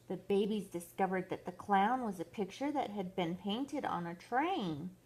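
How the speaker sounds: usual speaking voice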